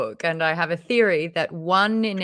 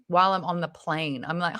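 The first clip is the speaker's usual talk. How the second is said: usual speaking voice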